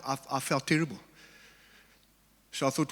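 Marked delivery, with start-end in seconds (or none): none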